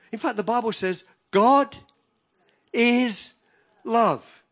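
high, loud building sermon cry; full-force preaching. Acted. Preacher rousing the congregation, loud and high. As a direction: preach it out to the hall.

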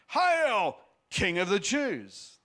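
loud, high mock salute; mocking hail. A narrator's reading. Soldiers mocking Jesus, loud mock salute. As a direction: jeer it like soldiers saluting a fake king. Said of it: "they mocked".

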